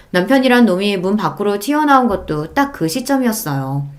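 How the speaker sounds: plain reading voice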